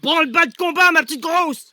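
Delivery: high, loud call to arms; alarmed shout. Acted. Man rousing his partner to battle, alarmed shout. Sudden alarm during secret business.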